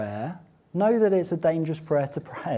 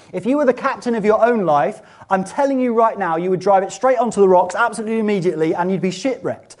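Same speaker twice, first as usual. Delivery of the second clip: impassioned warning; 7 semitones higher, 8 dB louder, faster than usual. Acted.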